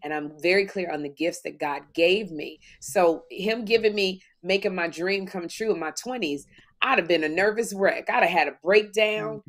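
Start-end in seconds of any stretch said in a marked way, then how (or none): none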